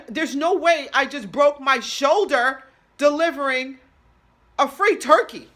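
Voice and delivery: high, loud, drawn-out disbelief; loud incredulity. Acted. Injured shoulder delivering a free turkey, loud incredulity. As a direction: stretch it out loudly, incredulous.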